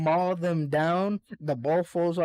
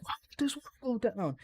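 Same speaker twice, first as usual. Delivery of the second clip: higher, fast, put-on puzzled voice; mock confusion; 9 semitones higher, 5 dB quieter, faster than usual. Attackers puzzled that the wall fell, in a higher, fast mock voice. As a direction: sound baffled.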